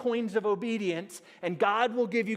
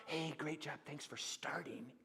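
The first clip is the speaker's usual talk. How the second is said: soft, half-whispered mock thanks; a hushed, throwaway mock thank-you; mock half-hearted praise; 6 semitones lower, 13 dB quieter than usual. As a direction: breathe it out like a lazy pat on the back. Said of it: "he doesn't want".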